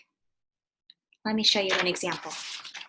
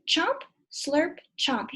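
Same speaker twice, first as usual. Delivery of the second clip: higher, punchy chomp and slurp; chomp slurp sound; 5 semitones higher, 4 dB louder, much slower than usual. Shown as a demonstration. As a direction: act out eating noisily. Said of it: "Your turn".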